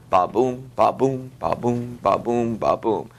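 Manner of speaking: each "ba" light, each "boom" stressed; iambic heartbeat rhythm. Shown as a demonstration. Teacher sounding out the syllable emphasis, steady weak-strong beats. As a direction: beat out "ba boom" in even, separated weak-strong pairs. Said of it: "you can see the emphasis".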